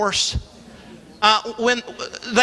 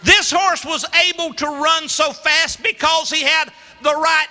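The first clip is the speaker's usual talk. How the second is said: preaching; 4 semitones higher than usual. Acted. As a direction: drive each line home from the pulpit. Preaches the point at full force.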